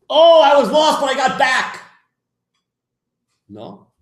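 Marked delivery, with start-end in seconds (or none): 0.1-1.8 high, loud mocking exclamation; mock triumphant cry. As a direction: lampoon the sinner who thinks he came back. Mocking a sinner's triumphant return.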